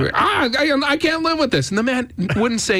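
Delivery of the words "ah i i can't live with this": high, whiny mock complaint; belly-aching Chris. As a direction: whine it like a diva. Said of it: "The belly aching Chris did for months".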